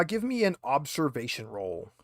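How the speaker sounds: normal talking voice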